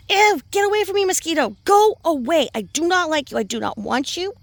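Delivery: squealing at a pest; shooing a mosquito. Real, not acted.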